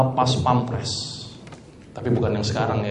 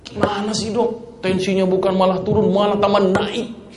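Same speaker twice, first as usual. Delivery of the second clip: mock annoyed patient, pitched up; a hint of an upset patient griping to his doctor; complaining patient; 6 semitones higher than usual. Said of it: "sambil marah-marah".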